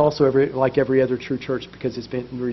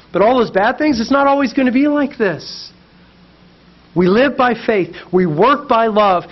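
higher, louder, rousing preacher; fervent preaching; 7 semitones higher, 4 dB louder than usual. Real, not acted. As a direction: lift the room with hope. Preaching at full force.

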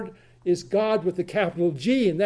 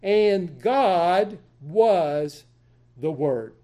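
very slow, word by word: "And God was the Word"; slow literal reading of "God was the Word"; 3 semitones lower, much slower than usual. Shown as a demonstration. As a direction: lay out "And God was the Word" one word at a time. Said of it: "Here it is".

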